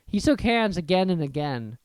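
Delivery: usual speaking voice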